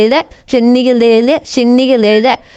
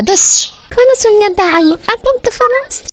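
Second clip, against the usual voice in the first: high pleading voice; 9 semitones higher than usual. Acted. Pet begging owner to stop.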